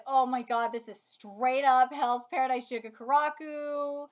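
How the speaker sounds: usual speaking voice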